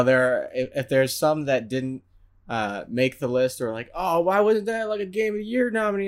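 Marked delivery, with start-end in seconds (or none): 3.9-6.1 lightly voiced high-pitched gripe; a hint of aggrieved whine; mock complaining listener. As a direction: imitate a put-out fan, pitched up.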